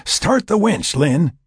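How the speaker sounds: loud, high-pitched command; ordering. A narrator's reading. Frank to Lynn as he pushes the pod, ordering. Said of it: "he ordered".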